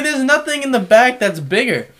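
exasperated. Retold or imitated. Exasperated retold reply.

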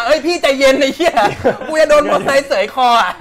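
high, loud frantic warning; yelped plea to calm down; alarmed friend. Retold or imitated. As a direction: imitate a panicked friend pulling him back. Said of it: "ไอ้เหี้ยท็อดอะ ไอ้เหี้ยท็อดดึงคอกูกลับมา".

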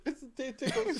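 high, giggly little protest; squeaky-high, laughing through the words; mock ticklish. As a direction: pretend to be helplessly tickled. Playing dead while being licked.

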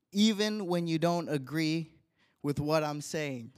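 ordinary delivery

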